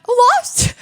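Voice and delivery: high, loud, crying-out mock despair; shocked wail. To the judge about her lost game, shocked wail. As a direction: wail it like the world ended. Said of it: "I was like".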